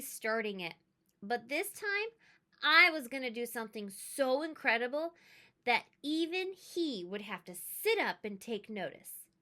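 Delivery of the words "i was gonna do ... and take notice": plain reading voice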